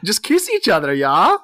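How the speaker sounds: high, eager mock voice; coaxing festival guy. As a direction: egg them on, rising on yeah. Imitating German man coaxing two girls.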